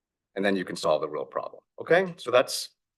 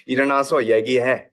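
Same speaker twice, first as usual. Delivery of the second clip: quick, louder Korean scolding parent; 3 semitones higher, 5 dB louder, much faster than usual. Retold or imitated. Immigrant parents scolding their son, fast Korean scolding. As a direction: snap it out like a strict Korean parent. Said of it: "in a very Korean way".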